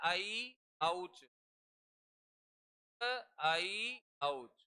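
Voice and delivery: drawn-out sutra syllables; slow recital. Shown as a demonstration. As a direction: stretch every sound slowly. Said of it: "देखो ए औच".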